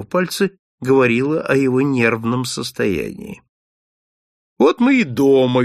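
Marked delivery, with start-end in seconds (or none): 4.6-5.6 louder, bright welcome; cheerfully. Acted. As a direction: announce arrival home with cheer. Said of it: "весело сказал Холмс". (весело, громче)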